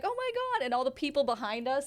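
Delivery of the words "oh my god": high-pitched squeal of "oh my God", reliving the shock; retold scream. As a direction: shriek it way up high, half-laughing disbelief. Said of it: "I'm like".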